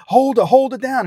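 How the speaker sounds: high, urgent call to hold her down; retold shout. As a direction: yell it like you're pinning someone. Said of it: "And we're like".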